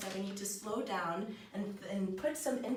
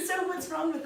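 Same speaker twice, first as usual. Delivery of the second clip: laughing; 9 semitones higher, 6 dB louder than usual. Real, not acted. Amused at eating horses.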